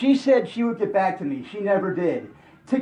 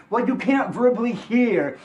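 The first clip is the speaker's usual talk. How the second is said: normal talking voice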